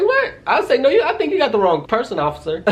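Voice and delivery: high-pitched stunned protest; shocked disbelief. Retold or imitated. Stunned protest to the officer at the door. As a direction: squeal it in disbelief. Police accuse her at door.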